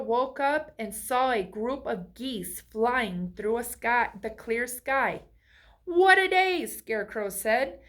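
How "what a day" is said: bright high exclamation of joy; cheerful exclamation. A narrator's reading. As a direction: greet the day with delight. Happy scarecrow greets morning.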